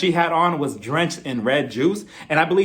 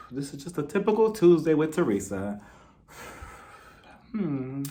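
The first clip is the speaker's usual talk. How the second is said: much softer, idle musing; unbothered; 8 dB quieter than usual. Retold or imitated. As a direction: mutter to yourself as if nothing's happening. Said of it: "they were just sitting there like".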